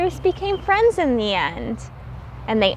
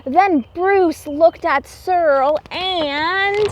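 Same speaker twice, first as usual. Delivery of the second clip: usual speaking voice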